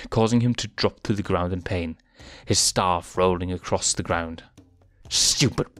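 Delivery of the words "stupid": cursing. A narrator's reading. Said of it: "he cursed".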